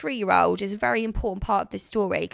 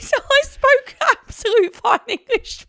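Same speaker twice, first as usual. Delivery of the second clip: high, squeaking laughter through the words; trying to stop laughing and failing; laughing apology; 6 dB louder than usual. Real, not acted. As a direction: still giggling.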